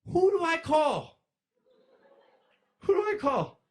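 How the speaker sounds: high, loud, slow panicked cry; mock panic. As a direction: wail it like you're freaking out. Panicked self-quote after kid sees wings.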